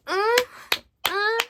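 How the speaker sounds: high loud moaning uh; mock moan. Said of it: "She starts going like".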